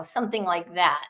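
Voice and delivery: normal talking voice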